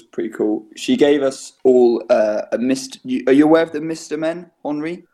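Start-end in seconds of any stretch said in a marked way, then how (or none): none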